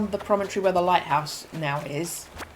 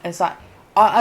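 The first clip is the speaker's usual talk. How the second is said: ordinary delivery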